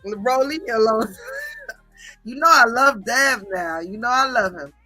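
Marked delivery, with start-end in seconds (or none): 1.2-1.7 high, soft giggle; real giggle. Real, not acted. Streamer telling a viewer leave me alone, soft high giggle. Laughs after teasing remark.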